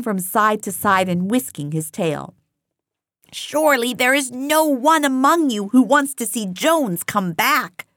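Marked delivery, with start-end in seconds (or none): 3.3-7.9 higher, urgent persuasive pig; pleading Squealer. A narrator's reading. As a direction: press the plea home. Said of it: "cried Squealer almost pleadingly".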